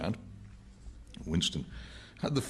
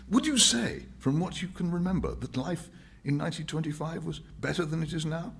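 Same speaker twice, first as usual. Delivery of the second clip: higher, earnest and persuasive; Winston's voice; 12 semitones higher than usual. Acted. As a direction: press the point to the old man.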